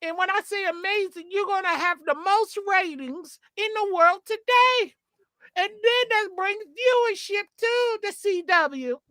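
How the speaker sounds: high-pitched, put-on wrestling manager pitch; managery voice. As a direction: hype it up like a manager selling a show. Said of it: "I'm doing my ... managery voice".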